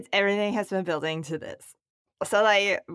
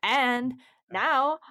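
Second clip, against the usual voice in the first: slow, higher sponsor announcement; announcer voice; 4 semitones higher, slower than usual. Acted. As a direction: announce the sponsor grandly and slowly.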